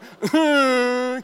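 one long, loud, high wail; hammed-up howl of crying; mock bawling. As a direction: imitate sobbing your eyes out. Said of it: "crying at the back".

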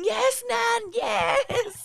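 high shouted cheer; cheering from the couch. Retold or imitated. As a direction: yell it at the TV like a fan. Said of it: "on the lounge going".

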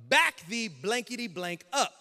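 slow, loud, emphatic order; angry censored order. Retold or imitated. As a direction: spell out the command with mock fury. Officer repeats order, swearing censored.

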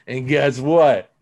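rising high and loud with joy, the last line slowed right down; excited triumph. Real, not acted. Telling the podcast how life got better, triumphant. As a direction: punch out the good news like a victory. Triumphant turnaround in recovery story.

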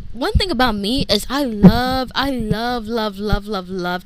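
loud, emphatic run of repeated love; gushing emphasis. Real, not acted. Podcaster declaring love for ongoing projects, gushing. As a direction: punch every love with real enthusiasm.

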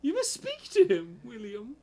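high, loud British lady gasping out the scandal; hammed-up posh British woman. Girlfriend telling boyfriend William about his naked flatmate, hammed-up posh British accent. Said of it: "you have to do a British accent".

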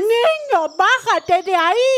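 high, loud frightened cry; alarmed shout. Acted. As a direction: yell at a child in danger. Boy balancing dangerously, grandma alarmed.

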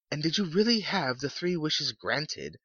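high-pitched woman's voice, wondering; curious old lady. A narrator's reading. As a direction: voice an inquisitive wife, pitched well up. Old lady questioning the soldier.

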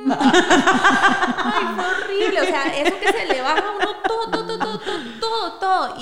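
can't stop giggling as she tells it; real laughter breaking into the story; laughing. Real, not acted. Laughing while recalling embarrassment.

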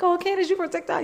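voicing staff who expected a jokey TikToker, lightly playful and high; jokey fan voice. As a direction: toss it off breezy and fun. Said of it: "they expected me to be all jokey".

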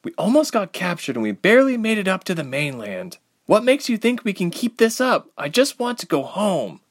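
high-pitched, pleading protest; defiant. A narrator's reading. Ilse telling Reshma she wants out, defiant. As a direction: protest with rising desperation. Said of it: "turning around defiantly".

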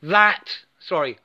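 ordinary talk, nothing marked

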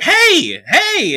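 high, loud hey hey across the bar; calling out hey. Retold or imitated. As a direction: hail someone you recognise.

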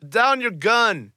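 loud barked order from the dark; shout. Acted. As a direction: bark the order at them. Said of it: "you hear a voice, um, shout".

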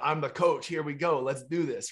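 rally them like an eager coach; coach mode. Acted. Trainer switches from fanboy to coach, lightly acted pep talk. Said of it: "on goes the".

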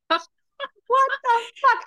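very high, slow, stretched shriek; a drawn-out high What the fuck; outraged disbelief. Real, not acted. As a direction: wail it out in disbelief. Reacting to unfinished story.

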